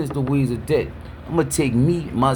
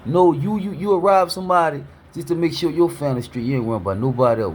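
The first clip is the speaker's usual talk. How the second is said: usual speaking voice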